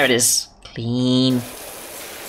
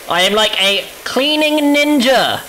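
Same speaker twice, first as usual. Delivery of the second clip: high, triumphant exclamation; playful boast; 6 semitones higher than usual. Pretend. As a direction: crow it, rising high on cleaning ninja. Boasts, then repeats low.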